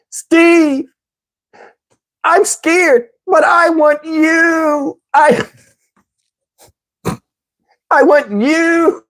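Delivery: high, whimpering, long drawn-out plea; mock-cry with longing, dragging the last word; imitating tearful woman. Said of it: "I'm gonna do her voice exactly how she said".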